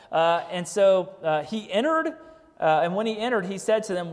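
normal talking voice

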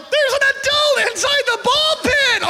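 high, loud mocking kids' cry; mock kids' shout. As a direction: shout like amazed children. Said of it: "Everybody's like".

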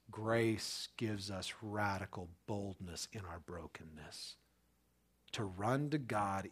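slow, soft whisper. Real, not acted. Pastor drawing the congregation close, slow whisper. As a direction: lean in and whisper it. Intimate sermon climax.

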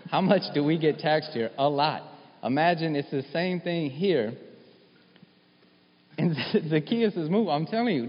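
high, loud, rising preacher's fervour; preaching at full force. Acted. Preacher urging his congregation that this is real, fired up. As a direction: preach it out, pushing toward the congregation.

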